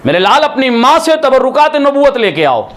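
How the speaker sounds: high, sustained wailing preacher's cry; preaching lament. Acted. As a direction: lift the line into a long mournful chant. Majlis sermon, sung lament peak.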